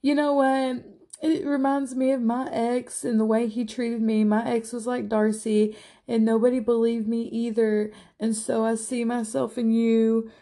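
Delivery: high, weepy imitation of Avery; Avery crying to Darcy, high and tearful; mock tearful. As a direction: play her teary confession, a bit hammed-up. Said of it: "she's crying to Darcy".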